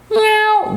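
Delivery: high, long cat meow. Pretend. Teacher imitating a cat for pupils, cat meow. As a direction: mew like a cat.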